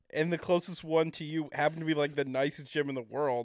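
ordinary talk

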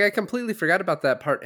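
startled; surprised realization. Retold or imitated. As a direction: say it as the memory hits. Retold surprise.

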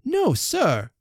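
high, sharp refusal; a lady's startled cry of no; cried out. Acted. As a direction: snap a startled no. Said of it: "cried Emma".

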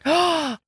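sharp delighted gasp; surprised gasp. Real, not acted. Tasting guessed drink, reacts.

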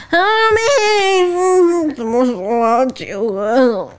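high crying-edged tipsy drawl, slowed down; imitating tipsy friend. Co-host mimics the drunk friend's question, whiny and slow. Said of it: "我要模仿".

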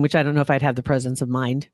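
ordinary delivery

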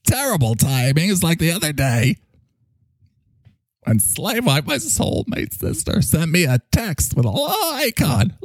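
swooping, theatrical scolding; indignant sidekick. Acted. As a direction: scold like a wounded diva. Mike scolds over a burp.

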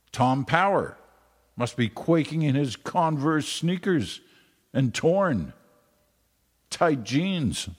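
hammed-up Newfoundland accent, pitched high. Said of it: "trying to do a Newfoundland accent there".

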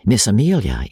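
ordinary delivery, nothing marked

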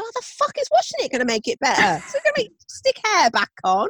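high, fast, indignant mock outrage; exasperated retort. Husband furious about washing his hair, mock outrage. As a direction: fire it back in disbelief. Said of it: "He said".

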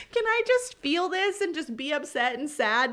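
high-pitched, half-joking plea; mock overwhelmed plea. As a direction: beg comically to be left to feel it. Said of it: "my feelings of being overwhelmed".

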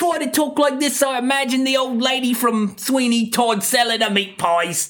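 hammed-up British pie-shop lady voice; old lady from Sweeney Todd. Shown as a demonstration. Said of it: "I'm just trying to imitate her".

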